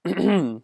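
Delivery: loud throat clearing. Real, not acted. Streamer whose voice is a bit hoarse, clearing throat.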